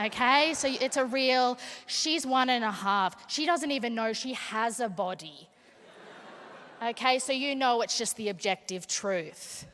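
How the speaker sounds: usual speaking voice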